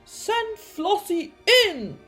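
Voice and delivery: high, slow, loud call; big drawn-out summons; shouted order. A narrator's reading. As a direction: shout it to the next room. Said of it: "Doctor Dribble shouted".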